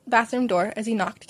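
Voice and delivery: ordinary delivery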